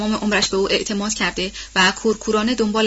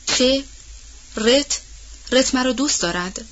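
stunned murmur; 4 semitones higher, slower than usual. A narrator's reading. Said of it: "متحیر و بی حرکت، زیر لب گفت".

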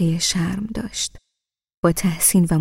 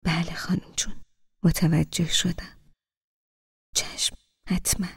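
hushed, breathy whisper; very quietly; 6 dB quieter than usual. Acted. Groom quietly assuring the grandmother, in a whisper. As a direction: barely breathe the answer. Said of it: "خیلی آهسته گفت".

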